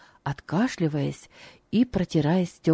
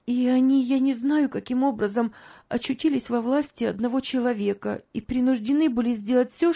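higher, pleading woman's voice; earnest young mother; 6 semitones higher than usual. Acted. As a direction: tell your story earnestly in a higher voice. Narrator voices the young mother's account.

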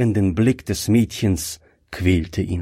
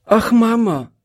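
higher-pitched cry to Mama; child's anxious call; 12 semitones higher, 4 dB louder than usual. A narrator's reading. Girl clutching her coughing mother's hand, anxious call. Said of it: "rief Gretchen".

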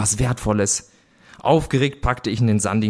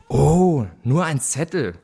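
higher-pitched disappointed "oh"; 4 semitones higher, slower than usual. A narrator's reading. Brother sees the find is only a note, disappointed. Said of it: "gab mein Bruder enttäuscht von sich".

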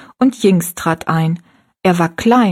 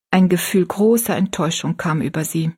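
ordinary delivery, nothing marked

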